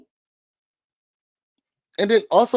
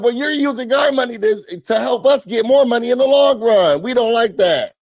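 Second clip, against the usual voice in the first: mock kids' complaint; 7 semitones higher than usual. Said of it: "they're like".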